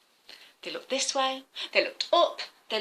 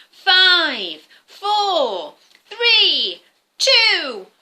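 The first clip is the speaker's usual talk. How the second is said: loud, high countdown to a blast-off cry; countdown shout; 9 semitones higher, 10 dB louder, much slower than usual. Acted. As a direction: count down with growing excitement. Second rocket countdown.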